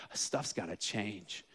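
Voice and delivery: low, slow and soft, a quiet vow; slow hushed resolve. Retold or imitated. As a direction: mutter it to yourself like a resolution. Zacchaeus resolving, quoted.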